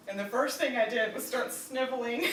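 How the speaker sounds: ordinary talk, nothing marked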